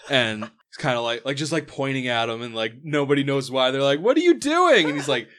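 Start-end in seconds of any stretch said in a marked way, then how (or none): 4.0-4.9 high-pitched, loud cry of disbelief; alarmed yell. Acted. Crew to Owlbear wrapping a chain, alarmed yell. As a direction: shout it in mock alarm.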